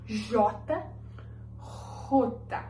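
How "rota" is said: rota breathed with a throaty R; whispered rota, R scraped in the throat; Spanish jota whispered. Shown as a demonstration. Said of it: "Sente que você tá arranhando a garganta".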